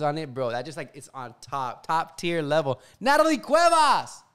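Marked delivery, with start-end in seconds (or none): 3.0-4.2 loud call across the house; high, loud and drawn out. Real, not acted. Calling her back from upstairs.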